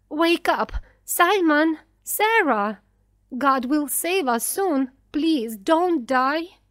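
loud, high calling to wake them; desperate plea. A narrator's reading. Mother calling to her dying children, desperate. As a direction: beg them urgently, stretching the last plea.

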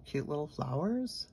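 normal talking voice

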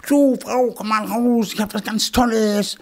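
high, eager call to his wife; can't wait to share the news; excited hedgehog calling. Acted. Hedgehog calls his wife out of the house, high and eager.

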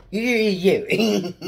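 loud, high playful you you; playful shouting. Pretend. Kid shouting you at someone as the Grinch does. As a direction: jab each you out loudly.

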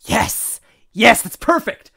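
loud, high triumphant exclamation; excited eureka outburst; real delight. Real, not acted. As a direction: celebrate finding just the right word. Delighted at finding the word.

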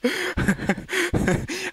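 laugh. Real, not acted. Laughs after scare.

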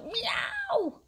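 very high shouted cat yowl; screeching meow. A narrator's reading.

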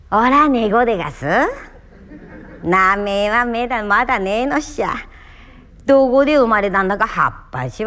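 story told in rustic Tohoku dialect. Shown as a demonstration. Actor showing one story in many dialects, Tohoku dialect recitation. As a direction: recite it with a broad Tohoku country lilt. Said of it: "いろいろな方言で喋ってみますから".

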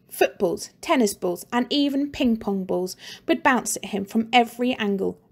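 ordinary delivery, nothing marked

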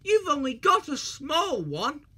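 high cheeky taunt. A narrator's reading. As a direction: poke fun at him. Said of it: "in his cheeky way".

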